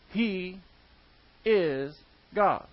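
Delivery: "He", "is", "God" set apart by pauses; stress on is. Real, not acted. Preacher declaring who Jesus Christ is, pausing around "is". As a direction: weigh each word, holding "is" on its own. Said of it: "Notice I didn't say was".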